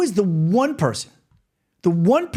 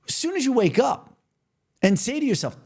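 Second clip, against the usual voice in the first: ordinary talk, nothing marked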